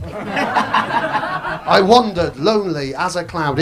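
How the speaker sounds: very slow, grand Wordsworth line; milk the poem like a ham actor; slow lofty recitation; mock poetry recital. Mock recital of famous poems.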